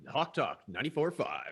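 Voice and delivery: station ID read like a DJ; radio DJ voice. Pretend. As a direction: announce the station call sign. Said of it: "it sounded like a radio".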